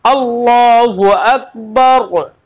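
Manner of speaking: very slow chant, full रा at the stop; Quranic recitation. Shown as a demonstration. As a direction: draw out Akbar ending on a thick रा. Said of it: "पुर पढ़ेंगे".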